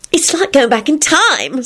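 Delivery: high, eager exclaim; thrilled by the old town; excited yell. A narrator's reading. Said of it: "Sludge yelled excitedly".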